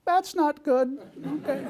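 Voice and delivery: high, laughing mock alarm. Patient on the table hears bad news, high laughing mock alarm. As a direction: squeak it out as a half-joking panic. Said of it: "I'll never forget going".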